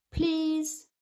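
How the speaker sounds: put-on eager small child answering; Peppa's voice. Peppa answering her teacher, eager. Peppa offering a magic word.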